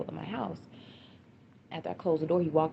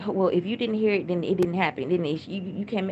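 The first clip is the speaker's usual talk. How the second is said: retold scolding, louder; reprimanding; 7 dB louder than usual. Police reprimanding the mother who reported it, reprimanding. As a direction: lecture dismissively, raising the volume. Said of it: "like, reprimanding me or something".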